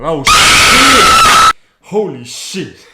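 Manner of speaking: sudden fright yell, very high and loud; real jump-scare shriek; startled scream. Real, not acted. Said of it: "You scared the hell out of me".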